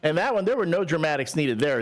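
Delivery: ordinary talk, nothing marked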